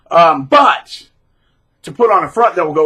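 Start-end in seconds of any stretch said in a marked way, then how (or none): none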